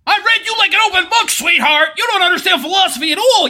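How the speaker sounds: high, fast scornful tirade; hammed-up derisive ranting; mock outrage. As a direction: fire back with theatrical indignation. Mock outrage at being welcomed.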